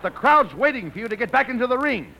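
normal talking voice

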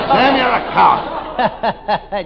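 high laughing taunt. Acted. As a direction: laugh through the insult.

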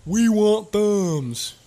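drawn-out, high silly voice; high slow creature voice. Acted. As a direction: stretch it out like a dumb creature. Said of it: "he's like".

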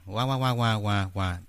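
muffled wah-wah cartoon grown-up; Charlie Brown's teacher. Mocking a woman's bad microphone, wah-wah teacher imitation. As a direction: drone meaningless wah-wahs like a muted trombone. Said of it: "sounds like the teacher from Charlie Brown".

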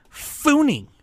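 high, loud squeaky Funi; high squeak. Pretend. Playful repeat of brand name.

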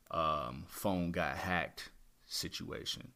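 ordinary talk, nothing marked

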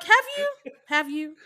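sassy doubting repeat; skeptical mock question. Teasing friends about fake accent, sceptical. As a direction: pitch jumps high on the first "Have you?", then drops back. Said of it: "I'm like".

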